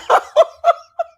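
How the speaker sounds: very high, loud burst of laughter; cracking up. Real, not acted. Streamer amused by her own retold holler, laughing hard. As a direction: laugh helplessly at your own outburst.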